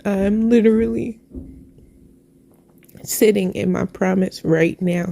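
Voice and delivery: ordinary delivery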